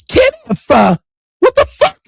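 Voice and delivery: very high mocking shriek of the name; mock squeal. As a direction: screech it like the girlfriend, mocking.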